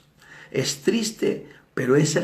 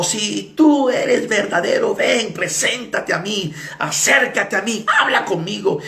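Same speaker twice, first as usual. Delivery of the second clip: shouting; 6 semitones higher than usual. Retold or imitated. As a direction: imitate a man daring God to appear. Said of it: "y gritó".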